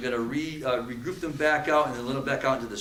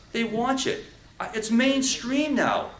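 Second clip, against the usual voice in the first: normal talking voice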